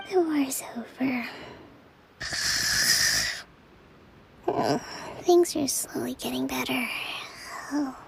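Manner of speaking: whispered sleep murmurs; sleep talking. Acted. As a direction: mumble softly in your sleep. Kokomi talking in her sleep.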